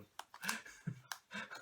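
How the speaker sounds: a long, high, airy, nervous laugh; breathless laugh. Real, not acted. Dreading losing the character, a long breathless laugh. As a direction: laugh helplessly at the grim prospect.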